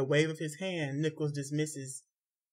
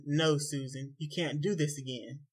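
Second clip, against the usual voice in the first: ordinary delivery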